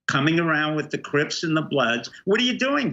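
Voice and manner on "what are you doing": high-pitched accusing question; indignant challenge. Acted. Guest calling out the governor over gangs, indignant. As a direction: throw it at the absent official.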